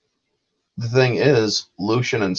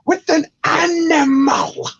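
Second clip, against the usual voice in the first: high, slow, far crying put-on voice; mock anguished wail. Host replaying Viktor's betrayal line, slow high wail. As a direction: drag out the outrage in disbelief. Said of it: "The way he says it".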